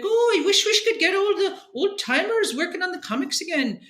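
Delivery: mock Scottish accent, pitched high; hammed-up Scottish impression. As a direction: put on a high, lilting Scottish voice to mimic him. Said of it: "I'm gonna do the Scottish accent".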